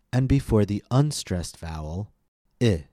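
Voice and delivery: ordinary talk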